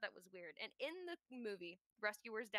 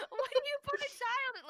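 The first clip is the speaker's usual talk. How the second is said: laughing question; 14 semitones higher, 7 dB louder than usual. Real, not acted.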